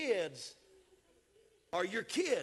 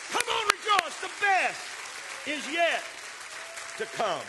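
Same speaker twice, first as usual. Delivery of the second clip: preacher's cry fading; 11 semitones higher, 6 dB louder than usual. Acted. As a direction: let the shout fall away at the end.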